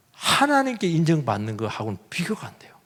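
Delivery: hushed and breathy; confiding whisper to the congregation. Real, not acted. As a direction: lean in and whisper it. Preacher drops to a whisper.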